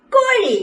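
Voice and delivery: high, bright കോഴി; emphatic model word; clear teaching word. Shown as a demonstration. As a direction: call കോഴി out high for babies to echo. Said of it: "പറഞ്ഞേ".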